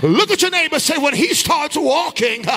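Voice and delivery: rising sing-song shout; preaching at full force. Acted. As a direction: drive the congregation.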